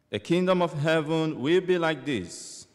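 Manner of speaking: solemn proclaimed scripture; gospel reading. Acted. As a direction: proclaim it like a church lector.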